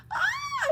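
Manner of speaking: very high loud ah; panicked shriek. Acted. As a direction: squeal in panic. Cinderella panicking.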